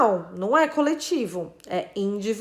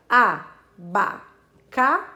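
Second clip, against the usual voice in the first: split into syllables; 3 semitones lower, much slower than usual. Shown as a demonstration. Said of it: "Tem quatro sílabas".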